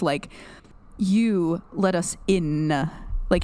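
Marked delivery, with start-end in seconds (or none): 1.0-2.7 very slow, lingering on the N of IN; Billy. Retold or imitated. Co-host showing how Billy lingers on consonants, IN drawn out. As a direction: roll out the N at the end of IN. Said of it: "he lingers on certain consonants".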